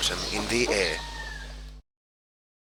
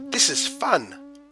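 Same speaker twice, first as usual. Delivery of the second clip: very high, slow buzzy insect voice; buzzing dragonflies. A narrator's reading. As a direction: buzz it like a happy dragonfly. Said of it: "buzzed the dragonflies".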